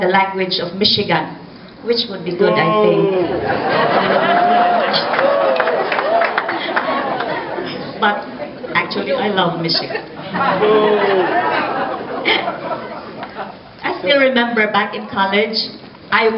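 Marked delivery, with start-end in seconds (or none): 3.0-7.9 long high-pitched giggle; high laugh. Real, not acted. Joke about Michigan. 10.1-13.5 laugh. Real, not acted. Keeps laughing at Michigan joke.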